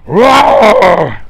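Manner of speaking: loud growling monster roar; cookie monster roar. Pretend. Streamer roaring at his chat through a mask, monster roar. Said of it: "I sound like a fucking cookie monster".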